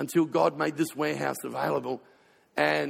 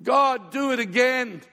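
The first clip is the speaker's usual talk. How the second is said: loud, higher and slow plea; retold pleading cry; 6 semitones higher, 4 dB louder than usual. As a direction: beg God again, slowly. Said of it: "I'm bawling my eyes out and I'm going".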